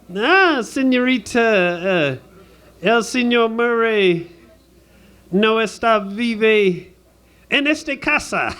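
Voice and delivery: high, slow, drawn-out fake Spanish accent; phony Spanish accent. Dodging American Express on the phone, phony Spanish accent. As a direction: stretch the vowels in a hammed-up Spanish accent. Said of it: "don't try that phony Spanish accent".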